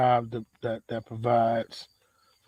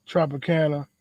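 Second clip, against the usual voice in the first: normal talking voice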